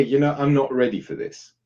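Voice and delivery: usual speaking voice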